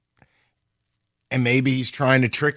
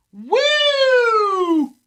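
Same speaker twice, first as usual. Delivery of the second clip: long, very high whoop; celebratory woo. Real, not acted. Player celebrating a right guess, drawn-out whoop. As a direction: hold a high victory woo.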